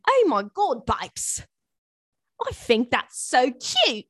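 playful mock British gushing; teasing British-accent coo; put-on British accent. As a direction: gush in a hammy British accent, slowing at the end. Puts on accent for New Yorker in England.

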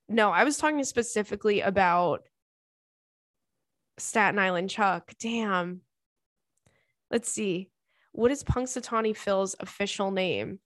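ordinary delivery

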